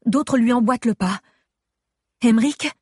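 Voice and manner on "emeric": sudden alarmed call, higher and louder; startled call. Acted. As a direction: call his name in alarm. Wakes to alarm, calls husband.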